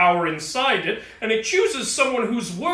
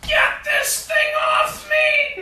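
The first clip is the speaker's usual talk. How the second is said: high strained cry for help, laughing through it; pinned and yelling to get it off; high-pitched panicked shout; slower than usual. Acted. Pinned under the heavy hammer.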